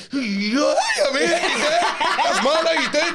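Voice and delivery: laughing. Retold or imitated. Realising it's a friend, laughing.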